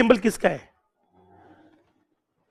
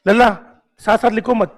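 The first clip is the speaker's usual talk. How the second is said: ordinary talk, nothing marked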